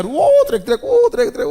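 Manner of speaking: loud, very high mock shrieks; shrieked "wow", high and loud; imitated screaming. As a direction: mimic people screaming from the graves. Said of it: "dengar orang teriak-teriak kanan kiri semua".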